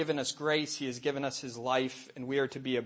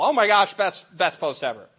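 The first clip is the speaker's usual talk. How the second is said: much louder and higher, mock rave; gushing readers; 4 semitones higher, 9 dB louder than usual. Mimicking fans gushing over her lazy blog post, a loud mock rave. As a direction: ham up the readers' over-the-top praise. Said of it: "fifty people".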